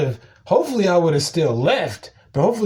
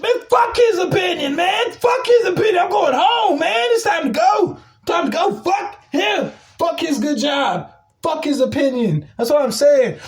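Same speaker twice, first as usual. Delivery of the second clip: worked-up yelling, voice pitched way up; exasperated rant; 12 semitones higher than usual. Real, not acted. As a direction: rant at the top of your range. Ranting about coworker's opinion.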